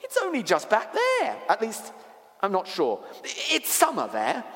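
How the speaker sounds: put-on high, eager child voice; little girl pitched high, hesitating; Lucy, earnest girl. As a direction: protest brightly, then grow unsure.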